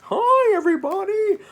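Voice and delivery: high, loud children's cartoon voice; cartoon character voice. Shown as a demonstration. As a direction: chirp it like a kids' show host. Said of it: "like a cartoon character".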